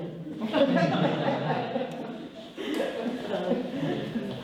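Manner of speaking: laugh. Real, not acted. Laughs at own joke punchline.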